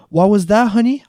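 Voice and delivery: high, loud motherly question; shouting. A narrator's reading. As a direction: call it down a noisy line. Mom shouting down phone.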